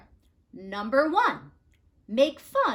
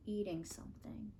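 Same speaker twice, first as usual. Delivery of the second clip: hushed whisper; 2 semitones lower, 15 dB quieter, faster than usual. A narrator's reading. Storyteller hushes the reveal.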